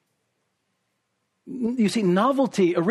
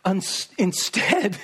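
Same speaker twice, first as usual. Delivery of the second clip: slow dramatic build; 3 semitones higher, much slower than usual. Shown as a demonstration. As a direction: drag out instead, very slow. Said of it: "now watch this".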